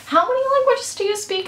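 high, squeaky imitation of her dad; imitating dad. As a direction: pitch way up to mimic her father. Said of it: "my dad the other day goes".

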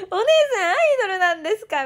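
high, loud and fast; loud gushing fan; loud excited question. Retold or imitated. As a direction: blurt it out in delight. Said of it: "でっかい声で言われて".

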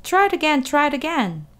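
goose urging. A narrator's reading. Goose urging Wilbur to dodge again, high. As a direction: cheer him on like a goose. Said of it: "cried the goose".

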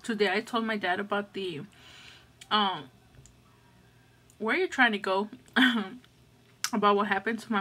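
talking with food in mouth; muffled between bites; chewing while talking. Real, not acted. Seen 1: lips closed and working between phrases, plate and fork in hand.

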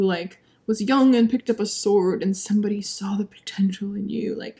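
unvoiced, hushed aside; whisper. Real, not acted. Reviewer whispering a confession to viewers. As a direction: drop to a secret whisper.

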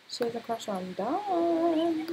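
playground-style taunt; teasing singsong. Real, not acted. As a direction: tease with a stretched, rising last word. Teasing repeat about a Sim's crush.

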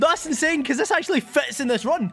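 high excited disbelief; real thrill at the find. Real, not acted. Shiny surprise reaction.

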